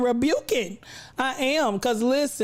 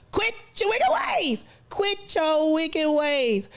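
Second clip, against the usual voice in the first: mock preaching; 5 semitones higher, slower than usual.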